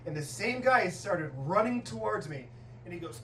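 normal talking voice

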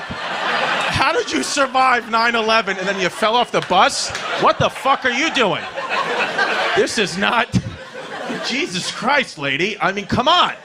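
acted outrage, incredulous at her clumsiness; exasperated scolding. Son berates mother who survived nine eleven, exasperated.

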